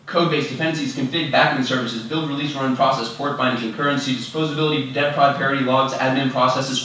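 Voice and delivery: rapid-fire recital without a breather; rattled-off list. Pretend. Speaker racing through twelve factors for the audience, rattled off. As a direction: reel the items off briskly, dropping at the end.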